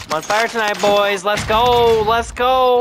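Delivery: loud, high, hyped-up celebration; excited hype. Real, not acted. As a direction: cheer the squad on after the kills. Celebrating kills with team.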